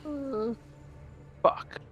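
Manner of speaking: ordinary talk, nothing marked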